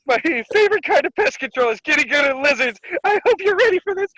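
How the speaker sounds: very high, loud mock voice; hammed-up high-pitched imitation; Nami. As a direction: squeal it in a put-on cartoon voice. Voicing a character posing as Nami.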